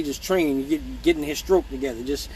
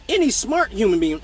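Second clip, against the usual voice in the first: normal talking voice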